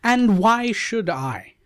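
first pleading why, high; pleading maiden. Acted. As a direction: plead your case as the young bride. Savitri protests her choice.

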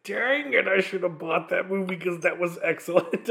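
high-pitched mock exasperation; exasperated regret. Viewer kicking himself for only renting the movie, exasperated regret. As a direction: kick yourself, pitched well up. Voicing own regret after watching.

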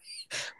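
short quiet laugh; a quick laugh at the story; a little amused laugh. Real, not acted.

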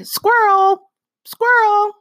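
high, loud mock-distracted cry; excited exclamation. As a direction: yelp it out like a sudden distraction. Mimics a distracted person's sudden cry.

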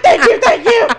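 high, loud, laughing thank-yous; squealed, giddy relief; relieved gushing thanks. Retold or imitated. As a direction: gush gratitude at being rescued. Friend relieved to escape.

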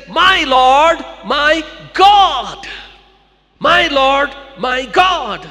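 loud stunned worship; awed cry. Acted. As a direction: cry out in worship on your knees. Thomas kneels before risen Jesus.

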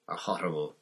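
ordinary delivery

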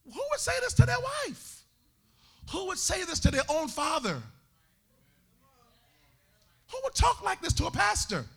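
rising rhetorical outrage. Acted. Preacher's rising rhetorical questions.

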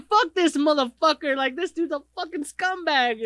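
replaying his own angry yell at the TV; retold angry rant. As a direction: vent at the screen like you can't hold it in. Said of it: "I'm the most expressive out of everybody".

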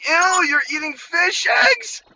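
put-on disgusted girl. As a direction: mimic a grossed-out classmate. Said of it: "She's like".

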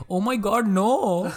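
put-on high-pitched pleading in an Indian accent; mock-terrified Indian-accented robbery victim; game shopkeeper's Indian accent. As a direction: panic in a hammed-up Indian accent. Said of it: "the Indian guy in G T A five".

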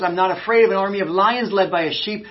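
second, passionate telling of the quote; fervent preaching quote. Retold or imitated. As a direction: proclaim it to the whole church. Alexander quote repeated with fervour.

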